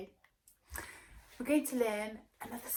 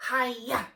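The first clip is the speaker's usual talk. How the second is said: loud karate shout; loud hiya; ninja yell; 3 semitones higher, 9 dB louder than usual. Acted. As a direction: kick with a yell. Ninja move.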